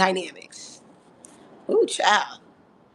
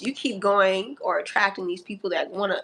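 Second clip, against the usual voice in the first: usual speaking voice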